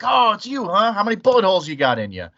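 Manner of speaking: high-pitched mocking imitation that drops as it runs on; teasing classmates. Mimicking classmates joking about crime, teasing. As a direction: mimic nosy teasers, starting high.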